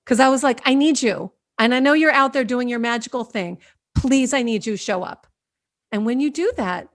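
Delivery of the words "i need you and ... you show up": firm, full-voiced direct appeal; summoning. Shown as a demonstration. How she really asked the dolphins, firm summons. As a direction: summon them with conviction, no meekness. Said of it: "I summoned those dolphins".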